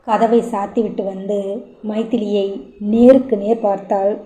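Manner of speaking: ordinary delivery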